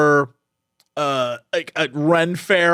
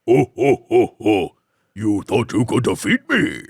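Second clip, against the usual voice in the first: much lower, menacing villain laugh; Dark Lord; 11 semitones lower than usual. Acted. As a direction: gloat like a deep-voiced dark lord. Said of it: "I am the Dark Lord".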